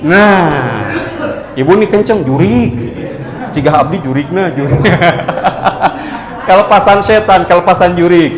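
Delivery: chuckling through the words; laughing. Real, not acted. Preacher laughing at the old Sundanese warning. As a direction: laugh as you say the Sundanese phrase.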